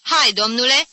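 bossy "come on"; authoritative summons. A narrator's reading. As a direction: order him along. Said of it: "semn autoritar către Jim".

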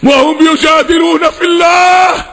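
high, ringing proclamation of the verse; fervent, high-pitched scriptural declaration; forceful recitation. Real, not acted. Repeats verse with full force.

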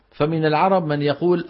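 usual speaking voice

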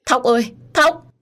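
put-on high, loud, drawn-out cry of a child's name; a mother screaming as her child is thrown; anguished scream. A narrator's reading. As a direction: scream for your child in terror. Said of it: "Tôi hét lên".

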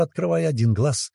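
ordinary delivery, nothing marked

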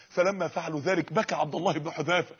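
ordinary talk

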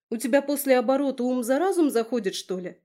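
venomous. Acted. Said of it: "ядовито прошипела Кира".

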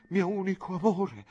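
voce più acuta, appassionata; Clelia innamorata. Acted. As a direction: dichiaralo con slancio disperato. Clelia declares love, dying lover. (Clelia appassionata al suo amato)

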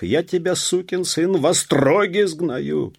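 very high, loud shouted threat; furious threat. A narrator's reading. Police officer stamping at the peasant, furious. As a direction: yell the threat high and hard. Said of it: "затопал пристав".

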